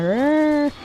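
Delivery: high, drawn-out "arr"; mock panicked cry. Imitating a kid who fell off the ladder, mock cry. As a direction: squeal like the kid getting away.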